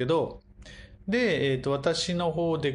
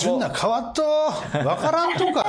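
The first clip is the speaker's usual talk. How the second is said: Kumamoto dialect; 11 semitones higher, 5 dB louder than usual. Acted. Host teases co-host in laughing Kumamoto dialect. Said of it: "熊本弁か".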